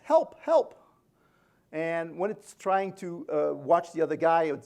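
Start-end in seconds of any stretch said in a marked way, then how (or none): 0.0-0.8 high, loud mock yelp for help. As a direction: yelp it like a panicking robot. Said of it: "it yelps".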